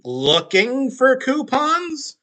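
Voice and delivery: high, slow mocking witch voice; witch voice echo. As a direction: repeat it in the witch's voice. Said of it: "evil witch-sounding tone".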